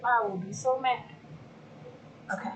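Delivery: ordinary delivery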